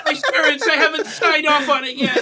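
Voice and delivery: high, loud laughing mock cry; laughing high moan. Mock moaning in bathroom bit.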